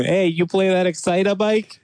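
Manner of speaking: loud, high New England caricature; mock New Englander. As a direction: call it out like a hammed-up New Englander. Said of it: "New Englander type thing".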